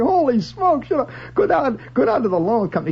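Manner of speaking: high-pitched holy-smokes disbelief; amazed inner voice. Retold or imitated. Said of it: "And I'm thinking".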